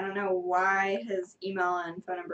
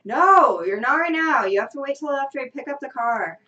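firm no to dog; 5 semitones higher, 7 dB louder than usual. Acted. Phil refusing the dog's walk.

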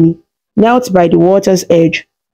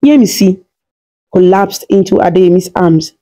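plain reading voice

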